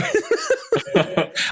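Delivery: high, giddy mock laugh; high falsetto laugh. Friend finishing the prince impression with a laugh. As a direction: cackle high like the movie prince.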